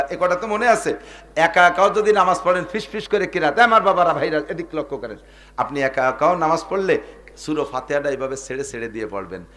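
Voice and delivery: normal talking voice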